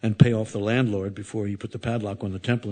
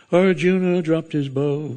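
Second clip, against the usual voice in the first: slow, higher recited line like a record; record playing; 6 semitones higher, 4 dB louder, slower than usual. Imitating the record heard down the hallway, slow and higher. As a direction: lightly mimic the record's reciter, slow and raised. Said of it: "and you could hear".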